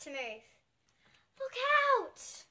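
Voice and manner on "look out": high urgent cry of look out; sharp high alarm call; warning shout. A narrator's reading. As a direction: yell a warning to a friend.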